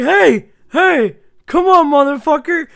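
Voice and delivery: high, loud shouts of protest; indignant yelling. Retold or imitated. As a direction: yell to be let out of the trunk. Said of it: "That's exactly what he says".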